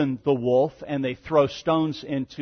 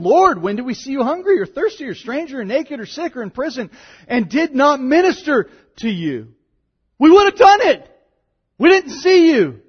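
high, loud, whining protest; protesting goats; 11 semitones higher, 10 dB louder than usual. Pretend. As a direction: play the indignant goats pleading innocence. Voices the goats protesting to the king.

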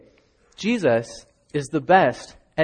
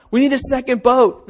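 calling out; 8 semitones higher, 5 dB louder than usual. Acted. Fishermen calling for partners.

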